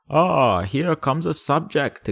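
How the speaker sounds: high, slow delighted royal greeting; a lonely king glad to see a subject; pleased king exclaiming. Acted. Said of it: "exclaimed the king".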